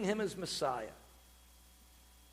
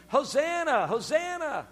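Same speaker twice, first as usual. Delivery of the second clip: higher, raised cry of praise; crowd crying out; 6 semitones higher, 4 dB louder, slower than usual. Retold or imitated. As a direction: imitate a shouting crowd, lightly. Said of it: "they're all shouting these".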